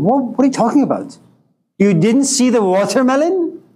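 baffled surprise. Retold or imitated. Woman baffled by watermelon question.

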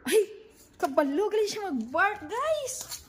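thrilled that the dog finally barked; surprised delight. Real, not acted. Dog suddenly barks. (gulat at tuwa, mataas ang boses)

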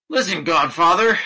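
high, loud call for attention; crying out. A narrator's reading. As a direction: hail him urgently. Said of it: "cried the young man".

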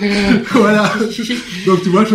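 laughing through the words. Real, not acted. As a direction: chuckle out the word. Laughing at his own joke. (en riant)

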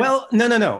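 quick high protest, no, no, no; surprised no. Real, not acted. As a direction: jump in to correct, surprised. Correcting the listener.

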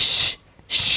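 long hissing "sh" noise; whistling hiss. Shown as a demonstration. Teacher shows students a hissing letter sound, hissed. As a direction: let the air hiss out. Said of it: "শীষ দিয়ে পড়া".

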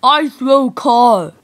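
dumb brute talking in grunts; slow, loud caveman speech. Acted. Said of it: "Rock buff".

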